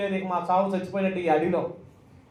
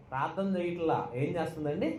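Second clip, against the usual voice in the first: ordinary talk, nothing marked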